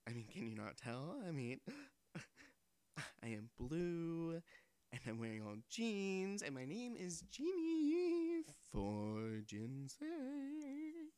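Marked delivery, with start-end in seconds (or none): 3.2-11.1 genie. Acted.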